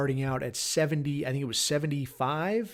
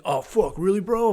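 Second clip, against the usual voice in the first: higher, louder startled "really, bro?"; surprised friend; 6 semitones higher, 5 dB louder than usual. Retold or imitated. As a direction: blurt it out in shock. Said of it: "He's like".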